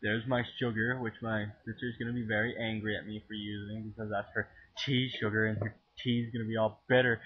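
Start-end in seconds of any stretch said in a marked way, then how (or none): none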